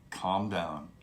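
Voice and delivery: soft, low and calming. Shown as a demonstration. Coach calming a panicked client, low soothing voice. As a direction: settle a panicked caller gently. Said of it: "I take my voice down a couple notches".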